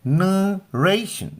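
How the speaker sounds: नरेशन very slow and high, "nuh" held in the nose; said through the nose. Shown as a demonstration. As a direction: say नरेशन as nnuh-RAY-shun, nasal first syllable. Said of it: "नाक से बोलेंगे तो that's better".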